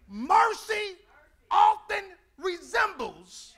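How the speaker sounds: loud, slow preaching build. Acted. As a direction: preach it out, drawing each word.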